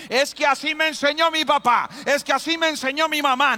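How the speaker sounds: high, loud mock whine of a stubborn parent; hammed-up excuse of a set-in-their-ways parent. Preacher mimics a stubborn parent making excuses, high and loud.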